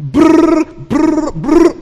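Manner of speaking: imitated printer brrr; printer noise. Pastor mimics the ticket printer for the congregation, buzzing. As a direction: buzz like a printer spitting out a ticket. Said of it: "he went like this".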